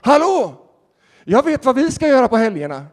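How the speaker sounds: high, loud hail across the changing room; booming excited announcement; calling out. Retold or imitated. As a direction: shout it out to grab everyone's attention. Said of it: "ropar han ut så här".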